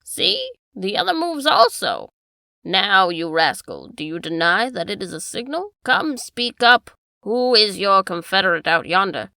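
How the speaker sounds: corner the rascal; angry accusation. Acted.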